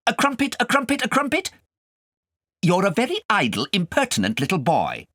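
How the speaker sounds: high, loud scolding governess; stern governess. Acted. Governess scolding the boy over his lesson, high and loud.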